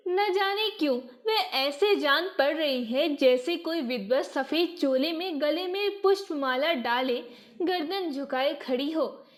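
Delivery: ordinary delivery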